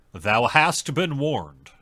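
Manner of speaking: slow, loud, grandly solemn; mock solemn warning. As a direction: intone it like an ominous decree.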